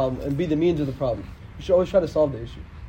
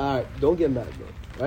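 gentle; 6 semitones lower, 7 dB quieter, slower than usual. Retold or imitated. Prophet's gentle advice.

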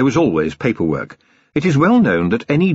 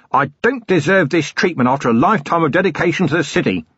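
indignant outburst, higher but not shouted; exploding; 8 semitones higher than usual. A narrator's reading. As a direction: protest hotly with raised pitch. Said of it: "thumped his desk and exploded".

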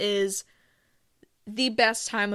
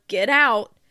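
playful get out; 9 dB louder than usual. Real, not acted. Telling Halloween haters to leave.

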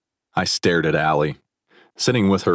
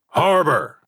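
sharp, higher shout of his name; coach shouting; 11 semitones higher, 3 dB louder than usual. A narrator's reading. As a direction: yell to stop the fight. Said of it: "Coach Carroll shouted".

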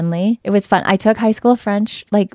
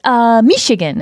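mock French accent; 5 semitones higher, 8 dB louder, slower than usual. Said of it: "you would have an accent".

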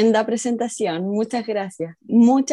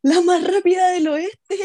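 laughing; 10 semitones higher than usual. Real, not acted. Teasing joke through laughter.

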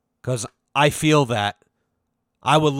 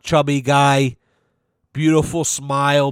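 ordinary talk

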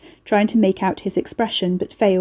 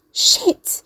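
horrified hiss; 13 semitones higher than usual. A narrator's reading. Mercy horrified.

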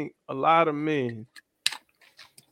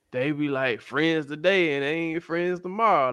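ordinary delivery

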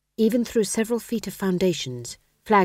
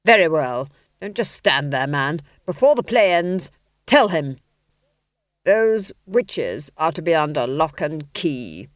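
duke's order; 5 dB louder than usual. Acted. Duke orders the footman.

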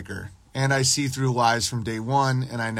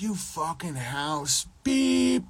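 higher, softer, slow sneering jab; mock taunt; 4 semitones higher, 3 dB quieter, much slower than usual. Imagined taunt at Stephen A Smith, sneering. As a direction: sneer it out slowly. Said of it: "I'd be like".

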